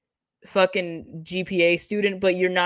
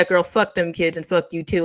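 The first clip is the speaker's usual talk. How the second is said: normal talking voice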